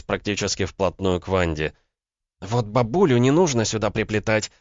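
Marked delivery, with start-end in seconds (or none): 2.4-4.5 restrained female irritation, pitched up; through clenched teeth. A narrator's reading. Woman defending her granny, irritated through teeth. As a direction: warn him off with tight, mild anger. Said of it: "процедила Ванда".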